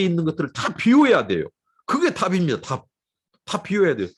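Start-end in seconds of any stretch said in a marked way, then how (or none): none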